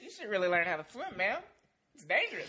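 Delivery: high and loud, teasing scold; mock scolding boy. Friend plays the boy scolding her about swimming, high and loud. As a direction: talk down to her like a prim kid.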